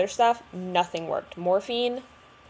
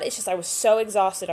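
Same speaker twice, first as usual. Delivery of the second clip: usual speaking voice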